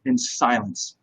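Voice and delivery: ordinary delivery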